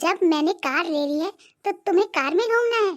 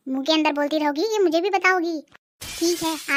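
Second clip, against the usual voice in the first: normal talking voice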